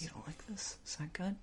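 creepy teasing whisper; a soft, taunting whisper. Pretend. Teasing whisper to potato-hater.

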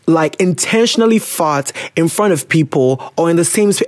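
ordinary talk, nothing marked

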